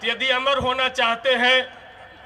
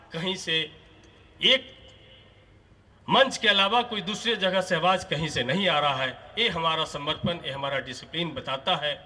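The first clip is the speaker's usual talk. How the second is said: ordinary talk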